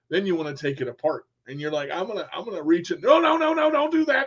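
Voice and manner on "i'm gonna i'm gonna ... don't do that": a hammed-up parent shooing a child off the power cord; mock scolding adult. Child scolded for touching computer.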